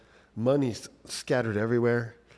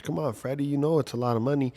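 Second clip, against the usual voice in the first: higher, quicker mocking taunt, a touch louder; taunting trash talk; 6 semitones higher, 4 dB louder than usual. The guy taunts dad with the cash, mock-taunting. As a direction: needle him, lightly voiced. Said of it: "the guy starts talking trash".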